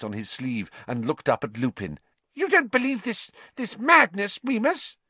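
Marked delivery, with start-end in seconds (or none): 2.3-5.0 high, squeaky, desperate voice; frightened rat-man whining; Pettigrew pleading. A narrator's reading. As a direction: beg a friend in a thin squeal.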